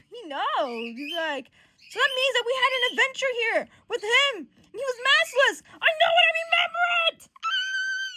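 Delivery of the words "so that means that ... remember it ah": high, loud, climbing to a shriek; frantic realization. Acted. Johnny recalling the maskless adventure, frantic rising shout. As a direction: build the insistence up into a squeal.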